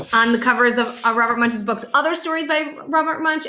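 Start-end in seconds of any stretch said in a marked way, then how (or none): none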